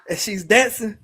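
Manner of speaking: normal talking voice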